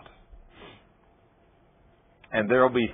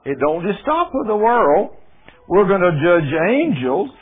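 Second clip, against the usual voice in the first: preaching at full force; 8 semitones higher, 7 dB louder than usual. Acted. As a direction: preach it out to the whole room. Reading scripture at full force.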